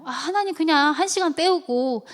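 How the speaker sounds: mock-exasperated bargaining in prayer; stressed pleading. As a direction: plead as if worn out. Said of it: "어떤 때는 스트레스 받아요".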